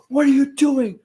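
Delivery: high-pitched mock yell at the brothers; mock angry yell. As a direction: bark it like an annoyed boss. Roman yelling at the Usos.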